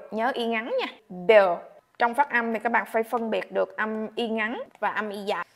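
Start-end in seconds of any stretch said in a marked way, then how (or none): none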